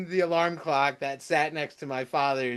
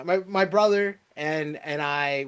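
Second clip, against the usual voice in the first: normal talking voice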